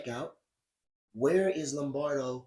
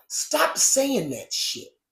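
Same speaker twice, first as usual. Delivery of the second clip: angry rant; 7 semitones higher, 5 dB louder, much slower than usual. Real, not acted. Rant demanding organizers stop.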